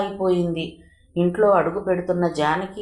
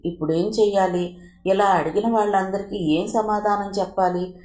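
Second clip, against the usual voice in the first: usual speaking voice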